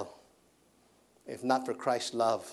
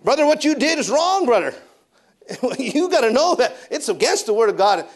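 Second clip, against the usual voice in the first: much higher, loud rebuke; preacher mode; 11 semitones higher, 10 dB louder than usual. Retold or imitated. Preacher rebuking the brother in full preaching mode, loud and high. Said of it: "and he's the preacher".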